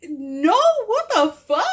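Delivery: high, loud outraged retort; indignant protest. Retold or imitated. Reacting to the accusation of cheating, indignant. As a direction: act out an incredulous outburst.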